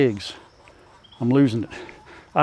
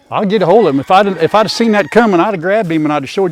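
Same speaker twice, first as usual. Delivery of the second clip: louder, sharper, fired up at the rooster; a real flash of temper; annoyed outburst; 4 semitones higher, 6 dB louder than usual. Real, not acted. Telling off the rooster that just jumped him, annoyed loud.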